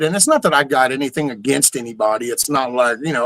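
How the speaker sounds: ordinary talk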